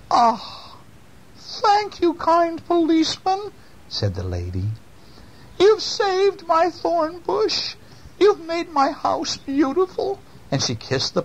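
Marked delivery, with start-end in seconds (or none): 0.1-3.5 put-on very high old-lady gushing; high-pitched storybook gratitude; grateful old lady. A narrator's reading. 5.6-10.2 put-on slow, very high old-lady voice; high-pitched storybook old woman; grateful old lady. A narrator's reading.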